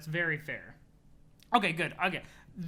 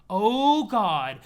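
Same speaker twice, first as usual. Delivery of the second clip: much louder, higher outraged cry; outraged exclamation; 4 semitones higher, 11 dB louder than usual. Acted. Troll reacting to a jab about an arm, loud outrage. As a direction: explode at the insult.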